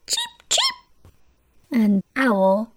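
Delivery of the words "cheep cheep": very high tiny cheeps; a sparrow chirping. Acted. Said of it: "The sparrows chirped".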